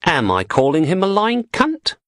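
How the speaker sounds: posh woman mocking the dealer to her partner; posh English woman. A narrator's reading. Blondie asks Elvis mockingly.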